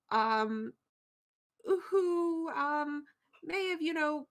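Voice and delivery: sheepish, hesitant plea with filler pauses; apologetic adventurer. Acted. Plays Remy apologizing to temple staff.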